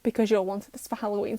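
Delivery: usual speaking voice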